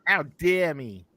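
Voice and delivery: loud echo in a mock New Zealand accent; mimicked mock offence. [name] echoing the scolding, mock-offended New Zealand accent. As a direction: throw the indignation back, New Zealand style.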